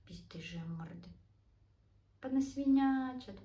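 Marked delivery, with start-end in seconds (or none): 0.1-1.1 hushed teasing scold; whispered scolding. Real, not acted. Scolding messy pets.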